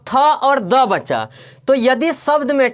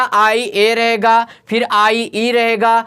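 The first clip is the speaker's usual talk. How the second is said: slow spelling; slower than usual. Shown as a demonstration. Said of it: "फिर से एक बार बात बता रहे हैं".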